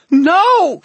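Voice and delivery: very high, very loud howl; howled scream. A narrator's reading. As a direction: howl it in despair. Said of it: "she howled".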